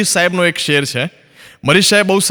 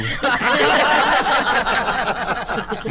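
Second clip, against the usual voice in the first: laughter; 3 semitones higher, 6 dB quieter than usual. Real, not acted. Laughing at own punchline.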